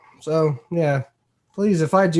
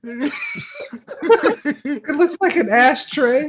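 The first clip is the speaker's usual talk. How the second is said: words squeezed out mid-laugh; laughing through pain; 10 semitones higher than usual. Real, not acted. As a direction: talk through a painful laugh. Still laughing after painful laugh.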